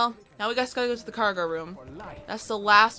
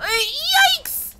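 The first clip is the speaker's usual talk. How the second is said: high, loud alarmed squeal; panicked "yikes"; startled yelp; 8 dB louder than usual. Real, not acted. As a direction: shriek at the sudden threat. Sudden danger in game.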